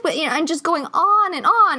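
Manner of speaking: ordinary delivery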